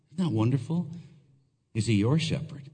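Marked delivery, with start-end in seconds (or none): none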